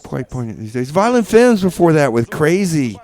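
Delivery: usual speaking voice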